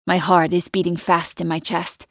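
ordinary delivery, nothing marked

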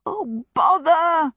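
slow, high wail of frustration. Acted. Sophie, whisked away again by the boot, high slow wail. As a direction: drag it out like a despairing wail. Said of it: "wailed Sophie".

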